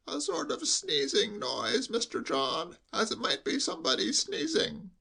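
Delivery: hushed, high sheep-like bleat. Acted. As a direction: bleat it confidentially, as through cotton wool. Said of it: "in a lowered voice".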